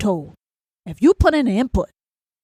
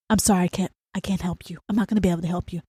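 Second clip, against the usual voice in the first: softer, lower voice, easing off; gentle polite refusal; 4 semitones lower, 5 dB quieter, faster than usual. Acted. Ranter starts with a mild no to someone asking, gentle refusal. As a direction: let them down quietly and apologetically. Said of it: "Start with".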